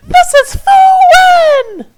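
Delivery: very high crying screech of wonder; ecstatic screech. Acted. Fairy screeching in wonder after the threesome, ecstatic. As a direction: squeal in utter ecstasy. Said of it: "screeched the fairy in pure wonder".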